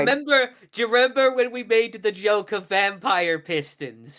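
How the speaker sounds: high, loud, gleeful rush; excited. Real, not acted. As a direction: burst out with delight. Player excitedly recalls a joke.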